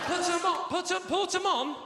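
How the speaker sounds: high, loud baffled repetition; mock confusion. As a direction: shout the name in bewildered panic. Shouting unknown place name.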